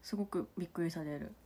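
「すごくびっくりされる」 muttered softly to herself; 「すごくびっくりされる」 half under her breath; quiet repeat. Real, not acted.

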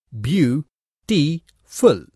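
"beau, ti, full" said as three separate syllables with pauses; split into syllables. Shown as a demonstration. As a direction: say "beau", "ti", "full" one beat at a time. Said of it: "has three syllables".

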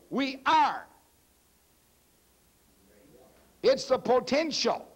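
high, measured proclamation; emphatic preaching. Real, not acted. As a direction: declare it to the congregation, pitched high. Preaches in raised voice.

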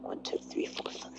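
soft unvoiced counting; whispering. Real, not acted. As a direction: count quietly under your breath. Counting players under breath.

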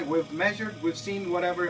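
ordinary delivery

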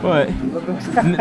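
ordinary delivery

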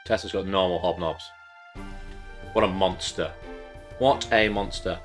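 ordinary talk, nothing marked

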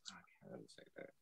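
unvoiced hushed confession; whispered aside. Real, not acted. Co-host hiding her pick from the host, whispered. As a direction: whisper it like a guilty secret.

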